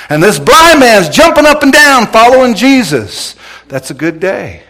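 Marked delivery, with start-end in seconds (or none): 0.1-3.4 exultant shouting. Real, not acted. Preacher excitedly tells of the revival.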